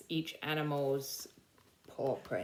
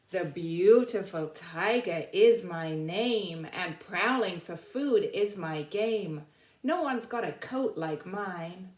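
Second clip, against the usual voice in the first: slow, proud, louder read-aloud; reading poem to children; 5 dB louder, slower than usual. Acted. Teacher reading the tiger's poem to the class, slow and proud. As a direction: savor each line like a storybook rhyme.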